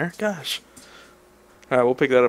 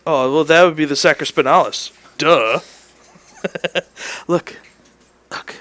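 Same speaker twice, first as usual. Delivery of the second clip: ordinary talk, nothing marked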